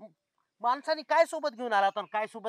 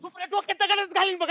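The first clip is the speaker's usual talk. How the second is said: धमकी; 7 semitones higher, 4 dB louder than usual. Acted. मित्र दगड घेऊन धमकावतो.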